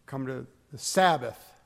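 normal talking voice

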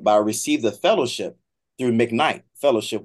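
usual speaking voice

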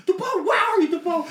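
high, loud mom calling for her son; imitating mom. Mom hunts her son over the mic, high loud imitation. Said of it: "'cause she'll be like".

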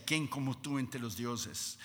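low, soft, reverent adoration; acted hushed worship; Moses praising God. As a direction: praise gently and intimately, voice well down. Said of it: "Moisés llegaba delante de Dios y decía".

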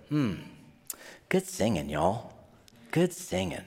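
lightly imitated Southern worship leader; a low, easy Southern accent, only lightly done. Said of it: "I can go into this accent anytime I want to".

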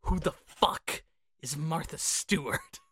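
ordinary talk, nothing marked

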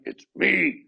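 a touch of sulk in a short reply; moody text. Shown as a demonstration. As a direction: say it like a sulky message. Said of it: "That could be like".

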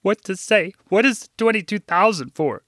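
put-on mildly choked-up older woman; understated teary voice; muffled tears. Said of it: "in between muffled tears".

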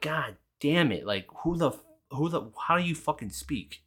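worked-up retelling of disbelief; retold exasperation. Retold reaction to confusing cashier.

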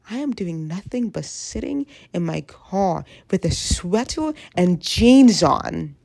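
ordinary delivery

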